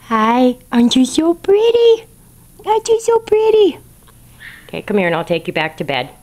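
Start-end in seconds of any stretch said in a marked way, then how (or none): 0.1-3.8 gushing. Real, not acted.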